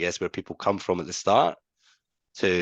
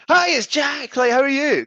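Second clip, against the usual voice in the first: much higher and louder, hammed-up chirpy greeting; over-the-top enthusiasm; 8 dB louder than usual. Salesman mocking his own overexcited pitch to a dull prospect. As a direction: overdo the cheery cold-call hello. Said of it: "if I went in going".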